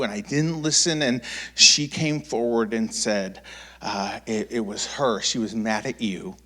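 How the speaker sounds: hushed sincere mother; soft apology. Retold or imitated. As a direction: apologize gently. Mom apologizing softly.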